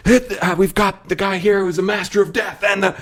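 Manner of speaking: high-pitched hype announcer; intro announcer impression. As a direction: imitate a ring announcer hyping the fighters. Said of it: "they'll have the long intros kind of thing".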